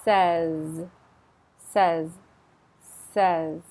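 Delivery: Says with stress on the start and a solid z; solid final Z. Shown as a demonstration. Teacher drilling says for learners, solid end. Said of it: "Stress on the beginning, solid on the end".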